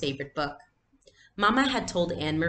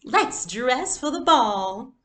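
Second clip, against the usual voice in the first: higher, louder and slow, like a lady at a ball; playacting doll voice; 8 semitones higher, 6 dB louder, slower than usual. Girl to her sister, inviting her doll to the ball, grandly. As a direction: sweep the words out grandly. Girls playing dress-up with dolls.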